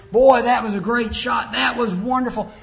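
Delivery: loud, high, hearty praise for a star athlete; a fan cheering the son's great shot, loud and high; enthusiastic praise. Retold or imitated. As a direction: gush over the great shot like a proud fan. People praising the athlete son.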